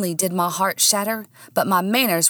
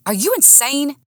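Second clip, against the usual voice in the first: high, incredulous demand; outraged question; 8 semitones higher than usual. A narrator's reading. Slapped man confronting woman, outraged. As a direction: demand it with pitch jumping up. Nick reacts to being slapped.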